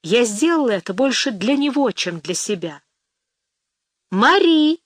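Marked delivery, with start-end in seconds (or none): 4.1-4.8 high, loud call of a name up the stairs; aunt shouting from upstairs. A narrator's reading. Aunt upstairs calls her niece late at night, loud call. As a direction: call Marie loudly from above. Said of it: "закричала тётя наверху".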